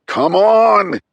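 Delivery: high, loud impatient yell. Acted. Reese yelling at the phone as it powers up, loud impatient shout. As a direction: shout at the slow phone to hurry up. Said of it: "he yelled".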